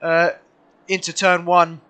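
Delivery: lively live race play-by-play; motorsport commentator. Real, not acted. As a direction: call the race as it happens.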